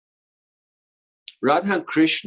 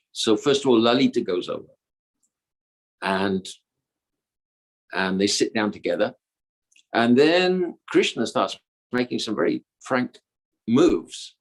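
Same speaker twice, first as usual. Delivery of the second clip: plain reading voice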